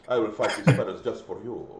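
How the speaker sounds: put-on low, soft Spanish-accented hero; mock Spanish accent. Repeating the spider-fighting joke to co-hosts, in a low mock Spanish accent. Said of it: "I don't know why I gave that accent".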